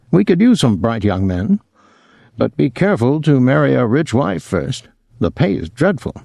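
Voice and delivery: a hint of a worldly host, advising; lightly voiced snobbish host. A narrator's reading. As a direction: lightly voice a snob giving career advice.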